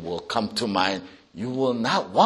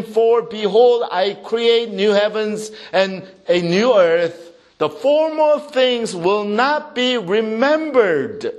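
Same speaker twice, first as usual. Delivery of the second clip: raised, emphatic Bible reading; scripture proclamation; 7 semitones higher, 4 dB louder than usual. Real, not acted. Preacher reading Isaiah to congregation, proclaiming. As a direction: proclaim the verse, drawing out key words.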